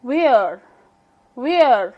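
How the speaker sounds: where with a clearly pronounced final r; clearly sounded R. Shown as a demonstration. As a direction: finish where on a distinct r. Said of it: "clearly बोल रही हूँ".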